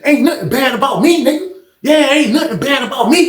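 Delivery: high-pitched, repetitive taunting; needling, worked-up challenge. Retold or imitated. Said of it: "just doing all this loud shit".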